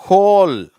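"ஹோல்" high and loud with a clear h; H sound for WH. Shown as a demonstration. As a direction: model "ஹோல்", starting on h, not w. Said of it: "இது ஹோல்ன்னு சொல்லணும்".

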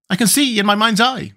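high, plaintive character voice; mildly mournful bug. A narrator's reading. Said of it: "said he mournfully".

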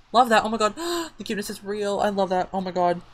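high-pitched gush with a gasp; delighted gushing. Real, not acted. Player watches the cat, gushing with delight. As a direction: coo excitedly over cuteness.

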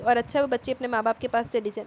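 plain reading voice

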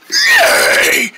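high shrieking horse neigh; whinny like a horse. Acted. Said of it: "that was a pretty good horse impression".

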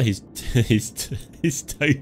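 breaking up with laughter mid-line; laughing. Real, not acted. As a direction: try to get the line out through real laughter. Cracks up starting the acted line.

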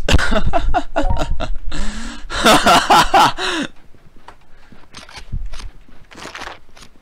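long, high fit of laughter; hysterical laughter. Real, not acted. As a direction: burst out laughing and keep going. Amused by what appears.

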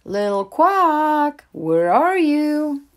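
slow, high, loud calling; Mama calls out for her missing duckling, slow and loud; called out. A narrator's reading. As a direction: stretch the call across the pond. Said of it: "Mama Duck called out".

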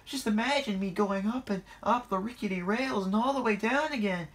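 very high, worried babble; alarmed cry. A narrator's reading. Percy imagining the rickety rails, high alarm. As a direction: keep it high and panicky.

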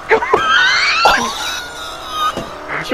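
high shrieking laugh. Real, not acted. Laughing at failed escape.

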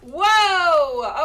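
long, loud whoa; big drawn-out yell of surprise; startled shout. Real, not acted. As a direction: cry out at a sudden enemy. Startled by unseen enemy in game.

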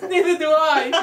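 baffled reply. Retold or imitated. Retold friend admits confusion.

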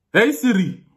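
high, loud Nigerian accent. Shown as a demonstration. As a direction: summon the assistant in a Nigerian accent. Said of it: "Nigerians, uh, when they ask about eggs".

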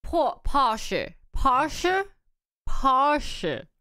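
Porsche as "PAH-shuh", no r; imitated pronunciation. Shown as a demonstration. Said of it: "Is that right?".

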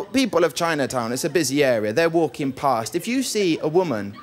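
ordinary talk

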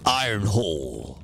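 deep, slow robot leader voice; Optimus Prime voice. Pretend. Streamer doing a deep transformer leader voice. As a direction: boom it out like a transformer commander. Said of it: "Sounds like a, an Autobot".

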